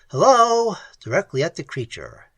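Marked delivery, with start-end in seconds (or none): 0.1-0.8 high, loud hello; yelled. A narrator's reading. Ham testing the creature, yelling hello at it. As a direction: yell it at the creature. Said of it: "leaned forward and yelled".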